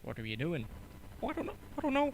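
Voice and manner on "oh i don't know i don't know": high falsetto cartoon mouse; very high-pitched cartoon impression; Mickey Mouse. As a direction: answer in a squeaky mouse voice. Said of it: "I'm Mickey Mouse".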